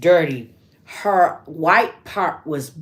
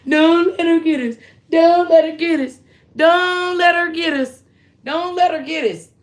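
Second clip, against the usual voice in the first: high, loud, panicked repeated pleading; a retold child's frightened cry; terrified pleading; 11 semitones higher, 5 dB louder than usual. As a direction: beg in terror, voice pitched way up and loud. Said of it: "And then I started crying".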